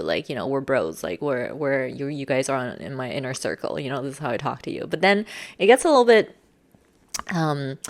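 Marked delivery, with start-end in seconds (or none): 0.0-4.9 low, familiar bro talk; lowered tone. Shown as a demonstration. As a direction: drop low and casual, as to close friends. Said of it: "I'll lower my tone to more so".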